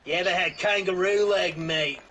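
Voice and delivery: hammed-up Aussie bushman; mock Australian accent. Friend does an outback bloke in mock Australian accent. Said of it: "That's just Australian way".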